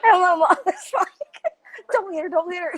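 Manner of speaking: high, laughing through the words. Real, not acted. Retelling mom's plea between laughs, high and laughing. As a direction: keep laughing while you tell it.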